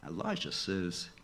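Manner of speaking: usual speaking voice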